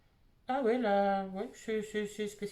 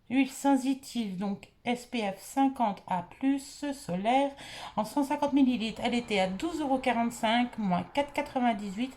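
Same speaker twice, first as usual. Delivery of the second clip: usual speaking voice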